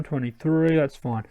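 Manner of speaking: ordinary talk, nothing marked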